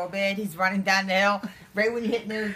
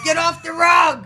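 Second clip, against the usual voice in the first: loud, slow shout; 8 semitones higher, 10 dB louder, slower than usual. Retold or imitated. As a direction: bark it out slowly. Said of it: "I'm like".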